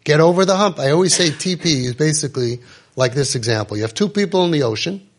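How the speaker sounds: ordinary talk, nothing marked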